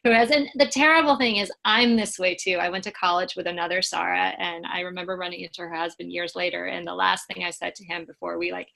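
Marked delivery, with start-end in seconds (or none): none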